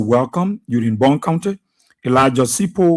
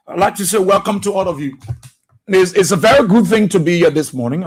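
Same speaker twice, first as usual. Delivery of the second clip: excited; 5 semitones higher, faster than usual. Real, not acted. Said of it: "I'm very excited".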